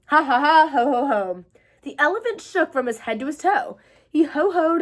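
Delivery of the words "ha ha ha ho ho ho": belly laughing. Acted. Elephant laughing hard.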